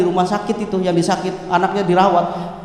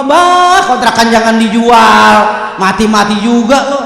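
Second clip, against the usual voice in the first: loud, drawn-out mock complaint; mock calling out; 7 dB louder, slower than usual. Child griping to dead father about rental house, loud mock holler. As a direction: holler it at the father, dragging the words. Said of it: "Tahu begitu mah".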